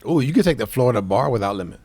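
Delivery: normal talking voice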